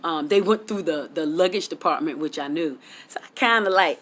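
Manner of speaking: usual speaking voice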